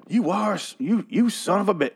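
high-pitched mock swagger, stumbling over it; hammed-up tough-guy marvelling; swaggering admiration. Reviewer as Negan marvelling at Eugene, mock swagger. Acting out Negan's impressed swearing.

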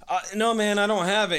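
mock-pleading, pitched well up; retold flustered denial. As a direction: imitate a cornered debtor pleading. Cornered debtor denies having money.

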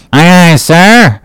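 high, drawn-out cheery answer; cheery cry. A narrator's reading. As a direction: sing out a willing reply to the captain. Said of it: "cheerily cried little King Post".

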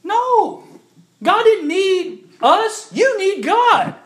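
loud, high, slow rebuttal; emphatic shout. Acted. Answering someone who said God was lonely, shouted. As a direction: slam the idea down.

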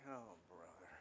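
low, soft, weary eye-roll groan; exasperated sigh. Retold or imitated. Jesus rolling his eyes at his disciples, low and weary. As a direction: sigh at the knuckleheads. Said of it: "Jesus rolled his eyes like".

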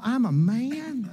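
husband boasting on the night drive, mock macho voice. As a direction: puff up like a proud he-man. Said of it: "I said".

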